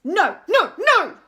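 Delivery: loud, high, separate blasts; thundering. A narrator's reading. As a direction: thunder each refusal at full force. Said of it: "he thundered".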